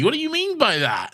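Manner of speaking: very high-pitched mock interrogation; insistent questioning. Real, not acted. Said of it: "whenever you question it".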